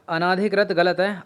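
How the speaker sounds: ordinary talk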